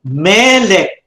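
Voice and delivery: "Melek" with a long, stressed first syllable; first-syllable accent. Shown as a demonstration. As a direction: lean on the ME of "Melek", let lek fall. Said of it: "notice how you pronounce it".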